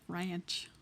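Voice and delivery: "ranch" said as "ray-anch"; stretched ranch vowel. Shown as a demonstration. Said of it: "R A Y A N C H".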